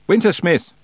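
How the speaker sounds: high, loud call; shout. A narrator's reading. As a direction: call out the name. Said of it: "she shouted".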